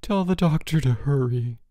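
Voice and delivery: high, feeble sickly moan. A narrator's reading. George from sickbed begging for doctor, moaning. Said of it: "George moaned".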